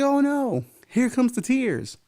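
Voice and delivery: mock-dramatic high "oh no"; jeering at the crying losers. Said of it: "It was like".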